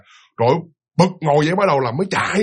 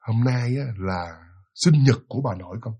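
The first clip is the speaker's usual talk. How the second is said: quiet sad reveal; 6 semitones lower, 4 dB quieter than usual. Retold or imitated.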